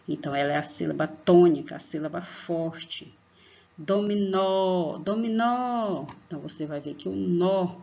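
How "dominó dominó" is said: "Dominó" called out, "nó" high and long; stress on last syllable. Shown as a demonstration. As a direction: stretch the "nó" of "Dominó". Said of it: "exige mais força na garganta".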